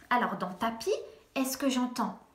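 normal talking voice

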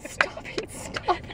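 hushed whisper. Retold or imitated. Friend shushing her in the theater, whispered. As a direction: hiss it to a noisy friend in the cinema. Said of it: "You were like".

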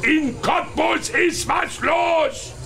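slow, stretched market cry; Marktschreier-Ruf. Real, not acted. Marktschreier ruft über den Markt. (gedehnter Marktschreier-Ruf)

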